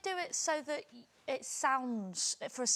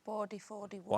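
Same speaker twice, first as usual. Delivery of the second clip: imitating Northern Irish accent; 3 semitones lower than usual. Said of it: "a Northern Irish accent".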